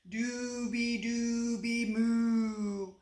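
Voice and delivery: slow, drawn-out sung cow moo; long, level mooing notes; snoring cow song. A narrator's reading. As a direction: croon it like a dozing cow. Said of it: "he heard".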